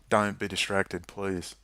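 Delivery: ordinary talk, nothing marked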